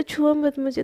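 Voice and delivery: usual speaking voice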